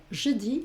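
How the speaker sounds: "je dis" with the final S left silent. Shown as a demonstration. French teacher models "je dis" for Spanish learners, final S silent. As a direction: say "dis" as "dee", dropping the S. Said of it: "la S final no se pronuncia".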